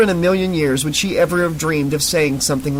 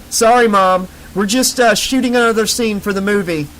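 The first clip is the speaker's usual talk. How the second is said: calling out; 6 semitones higher than usual. A narrator's reading. Calling out through garage door.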